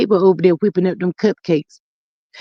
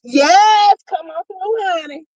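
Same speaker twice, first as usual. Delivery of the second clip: high, lively call in her signature voice; 7 semitones higher, slower than usual. Shown as a demonstration. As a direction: deliver the catchphrase with swagger. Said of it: "in my G voice".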